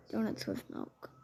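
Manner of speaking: breathy retold cashier murmur; weird whisper. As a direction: hush it oddly, like a strange cashier. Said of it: "the cashier said to me weirdly".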